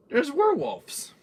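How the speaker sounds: high, slow, drawn-out gasp of news; mock-horrified high exclaim; dramatic reveal. Real, not acted. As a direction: reveal it like a horror twist.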